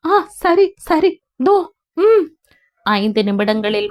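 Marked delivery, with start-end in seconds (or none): 0.1-2.3 flustered. Acted. Flustered reply.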